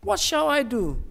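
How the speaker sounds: anguished outcry; desperate cry. Retold or imitated. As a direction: plead in desperation. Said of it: "she cried out".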